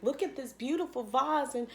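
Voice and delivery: proudly showing her dream house to her friend; excited showing off. Retold or imitated. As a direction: show off the house in a bubbly high voice. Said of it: "And I was like".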